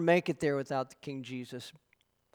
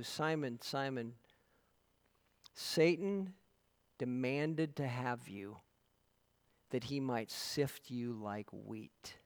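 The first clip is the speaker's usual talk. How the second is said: soft grave warning; 3 semitones lower, 7 dB quieter, slower than usual. Acted. Jesus warns Peter tenderly.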